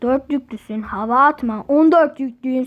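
ordinary delivery

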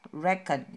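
record said REkord, first syllable loud, schwa after; stress on REC. Shown as a demonstration. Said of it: "dibacanya e".

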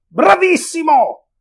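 long, drawn-out high cheer, slowed; hoarse shout. A narrator's reading. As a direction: call across from the enemy line. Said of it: "Uno con voce rauca gridò".

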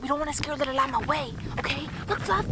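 ordinary talk

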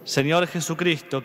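ordinary talk

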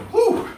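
breathy whew of effort; sharp high whew. Real, not acted. Breathless exhale mid-workout.

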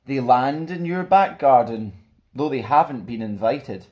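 put-on lively, bouncy picture-book narration for kids; animated rhyming read-aloud; storybook reading. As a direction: read it like a fun bedtime story to children. Reads picture book to children.

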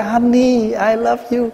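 high, sugary put-on voice for honey I love you; teasing impression of a lovey-dovey husband; mock sweet husband. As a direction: ham up a doting husband. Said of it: "phải hất ổng cái".

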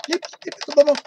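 high little beeping sound effect; mock beeps and booms. Showing off his grandparents' toy with beep noises. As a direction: imitate the toy's beeps, then booms.

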